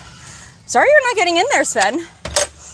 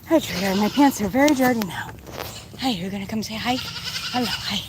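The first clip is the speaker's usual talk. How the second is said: ordinary delivery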